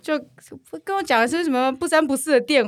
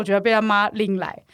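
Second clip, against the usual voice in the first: ordinary talk, nothing marked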